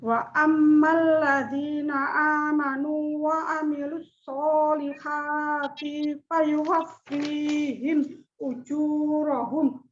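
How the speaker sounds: slow, measured chanting of the verse; Quran recitation. Acted. Opening her talk to the class with slow Quran recitation. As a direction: intone each phrase slowly and reverently.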